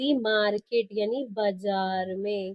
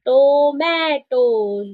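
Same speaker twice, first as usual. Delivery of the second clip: Tomatoes called out loud and very slowly; read aloud loudly; 3 semitones higher, 7 dB louder, much slower than usual. Shown as a demonstration. Teacher modelling loud reading aloud for children, loud and drawn out. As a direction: stretch Tomatoes out, loud for the class. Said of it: "अब इसको जोर जोर से बोलेंगे".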